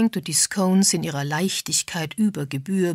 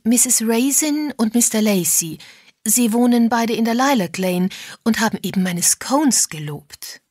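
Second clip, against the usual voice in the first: raised, louder placating voice; anxious wife; 5 semitones higher, 5 dB louder than usual. A narrator's reading. Mrs Parr introduces the villagers to her husband, over-eager. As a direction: introduce the guests too eagerly.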